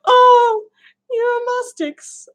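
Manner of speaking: high squeaky sigh after giggles; recovering from laughter. Real, not acted. Still laughing at the word.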